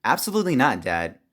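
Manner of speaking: indignant outburst, pitch climbing high; mad. Acted. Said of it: "why are you getting so mad".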